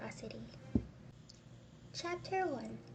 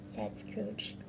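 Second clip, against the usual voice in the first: usual speaking voice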